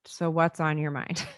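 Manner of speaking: slow, soft, inviting ask; slow open question. Shown as a demonstration. Mom asks her upset kid, slow and gentle. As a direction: leave room for the answer, gently. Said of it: "by just the open-ended".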